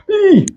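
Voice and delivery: mock wince squeal. Real, not acted. Reacting to ironic statement.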